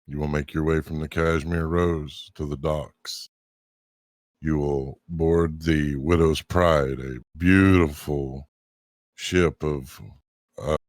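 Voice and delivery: unhurried storyteller narration; scene narration. Acted. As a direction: set the scene calmly. Game master's scene setting.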